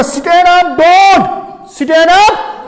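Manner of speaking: Stand up don't loud and high, drawn out; comma placement demonstration. Shown as a demonstration. Teacher showing students where the comma falls, loud comma demonstration. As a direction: punch out stand up don't, stretching the phrase. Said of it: "अगर मैं कामा यहां डालूंगा".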